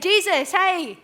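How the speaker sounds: tattle to the authority, all worked up; mock-exasperated Martha begging for backup; worked-up pleading. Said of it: "She's probably worked up".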